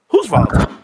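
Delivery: loud exclaim. Real, not acted. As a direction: throw it out loud to chat. Repeats it much louder.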